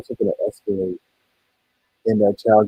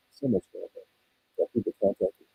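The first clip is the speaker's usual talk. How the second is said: muffled; 2 semitones lower, 9 dB quieter than usual. Real, not acted. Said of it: "Sorry if it sounds muffled".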